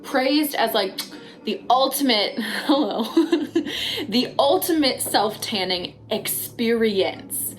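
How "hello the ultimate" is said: laughing. Real, not acted. Laughs mid-sentence.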